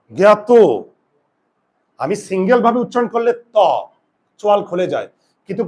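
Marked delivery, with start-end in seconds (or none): 0.1-0.5 জ্ঞাত said as গ্যাত with jaw kept shut; pronounced gyat. Shown as a demonstration. Teacher repeating জ্ঞাত as গ্যাত for class. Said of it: "তাহলে আমার উচ্চারণ হয় কি?".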